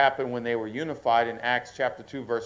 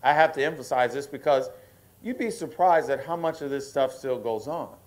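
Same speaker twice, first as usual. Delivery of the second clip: normal talking voice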